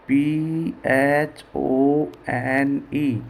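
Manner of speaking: very slow, letter by letter; each letter held apart; spelling out. Shown as a demonstration. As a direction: spell it out slowly for a class. Teacher spells the word out.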